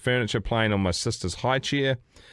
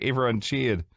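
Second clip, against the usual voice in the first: ordinary talk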